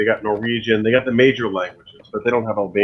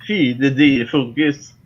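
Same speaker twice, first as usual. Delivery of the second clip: put-on slow Finnish accent, pitched higher; higher, halting Finnish-accented English; mock Finnish accent; 4 semitones higher, slower than usual. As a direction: imitate a Finnish accent, slowly. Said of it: "Did you just play the Finnish accent?".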